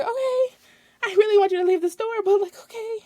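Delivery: high, fast, tight customer-service voice; strained fake politeness. Cashier straining to stay polite with a customer. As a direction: force a polite okay through the annoyance.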